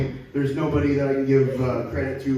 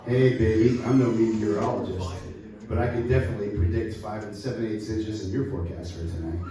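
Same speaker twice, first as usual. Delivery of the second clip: low, slick come-on voice, a touch hammed-up; smooth pickup line; 7 semitones lower, 4 dB quieter than usual. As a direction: croon it like a sleazy seducer. Cheesy pickup line to a woman.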